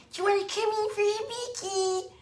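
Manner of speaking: high cooing groomer voice; lightly hammed sing-song groomer; imitating the groomer. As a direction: coo it like an overfriendly dog groomer. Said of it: "she would say".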